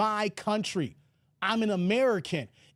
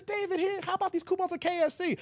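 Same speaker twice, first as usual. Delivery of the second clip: higher, quick imitation of mom; mother's voice; 7 semitones higher, faster than usual. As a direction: imitate mom offering coupons, pitched up. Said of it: "mom, you know, from the South".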